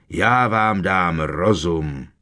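slow, restrained booming growl of a threat; booming Hagrid roar. A narrator's reading. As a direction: threaten them slowly as an angry giant. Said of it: "zaborácel Hagrid". (zaburácet, protahovaně a výhrůžně)

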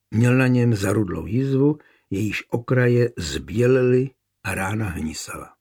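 plain reading voice

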